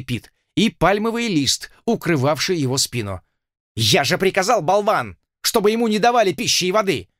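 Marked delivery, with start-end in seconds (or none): 3.7-7.1 angry colonel. Acted.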